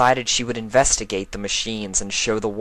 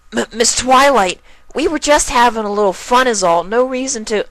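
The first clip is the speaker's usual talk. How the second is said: high colt voice, louder, stammering excuse; nervous colt; 8 dB louder than usual. Acted. Colt making excuses to Twilight, high and flustered. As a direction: squirm out of trouble.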